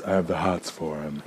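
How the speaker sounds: lightly campy drag queen voice. Shown as a demonstration. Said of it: "that's my drag queen voice".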